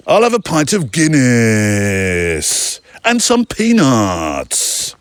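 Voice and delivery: slow drawn-out ad voice ordering a pint; mock voiceover style ordering at the bar; slow advert voice ordering Guinness and peanuts; voiceover in a pub. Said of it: "they'd ever talk like that in a pub".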